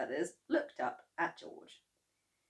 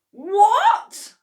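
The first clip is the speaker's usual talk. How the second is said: high, loud what; alarmed cry; 15 dB louder than usual. A narrator's reading. Mister Cranky desperate to know, loud cry. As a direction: shout it in alarm. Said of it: "cried Mister Cranky".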